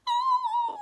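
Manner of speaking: very high tiny mouse voice; high squeaky mouse. Acted. Mouse character speaks.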